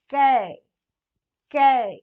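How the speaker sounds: 'कै' loud and held, with an open ai vowel; demonstrated syllable. Shown as a demonstration. Teacher modelling the sound for learners, 'कै' loud and drawn out. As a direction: punch out 'कै' and stretch its vowel. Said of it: "इसका उच्चारण".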